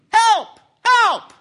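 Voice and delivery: high, loud cries for help; short high-pitched shouts; yelling for help. Acted. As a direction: yell it out, desperate to be heard. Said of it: "So Jack starts yelling".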